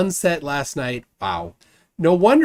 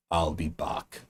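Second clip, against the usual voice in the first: put-on low, soft Austrian-accented action-hero voice; Schwarzenegger; 8 semitones lower, 7 dB quieter, slower than usual. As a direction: do an Austrian tough-guy catchphrase, pitched way down. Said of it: "That's my best Schwarzenegger accent".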